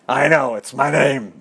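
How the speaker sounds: lightly voiced cartoon guy ladybug; deep-voiced ladybug. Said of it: "he has a really deep voice".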